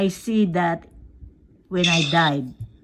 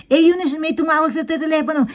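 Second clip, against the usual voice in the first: a hint of a nosy gossip, a bit higher; 5 semitones higher than usual. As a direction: lightly play the busybody calling out. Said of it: "ako naman yung machismosa".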